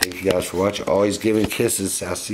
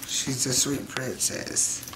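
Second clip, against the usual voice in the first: cooing; 6 semitones higher, 5 dB quieter, much slower than usual. Real, not acted. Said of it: "cooing at monkey".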